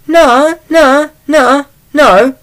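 a mock high woman's voice refusing; woman reporter objecting in a high pitch; squeaky woman protesting. Woman reporter refuses to stay quiet.

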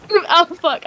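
ordinary talk

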